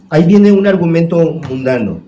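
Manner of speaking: ordinary delivery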